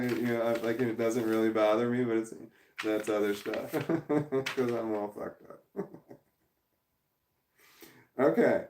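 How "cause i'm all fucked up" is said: laughing. Real, not acted. Laughing self-deprecation.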